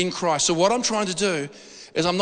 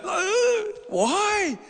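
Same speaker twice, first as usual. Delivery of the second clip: much higher, puzzled little "why"; 13 semitones higher than usual. Retold or imitated. Preacher voicing a puzzled believer, high and bewildered. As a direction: ask it like you can't believe it.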